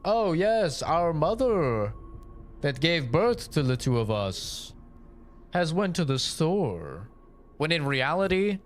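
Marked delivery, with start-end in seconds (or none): 0.0-7.1 higher, slow, overly proper reply; mock theatrical sibling explaining; stilted fictional sibling. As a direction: answer like a wooden scripted sister. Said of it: "the person's like".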